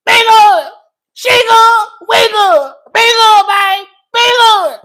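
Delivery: high, loud, frantic wailing plea; Doctor G voice. Retold or imitated. Host imitating Doctor G losing it, frantic high wail. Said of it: "I'ma do it in Doctor G voice".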